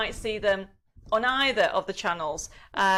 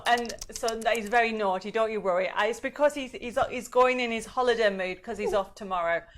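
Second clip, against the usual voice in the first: usual speaking voice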